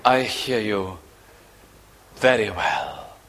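God gently telling the sinner he hears; reassuring. Acted. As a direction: reassure him quietly. God answering the prayer.

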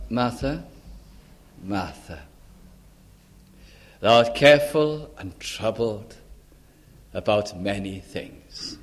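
soft, low, fading at the ends; Jesus gently correcting anxious Martha; gentle rebuke. Acted. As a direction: chide her tenderly and slowly, like a friend. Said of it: "He says it gently".

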